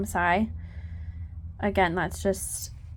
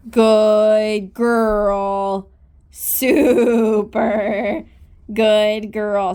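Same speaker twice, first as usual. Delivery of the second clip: booming praise for the horse; louder cheerful praise; 8 dB louder, much slower than usual. Real, not acted.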